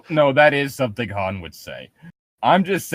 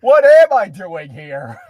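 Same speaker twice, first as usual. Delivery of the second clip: melodramatic wail; 4 semitones higher, 8 dB louder, slower than usual. Pretend. Voicing Callie melodramatically.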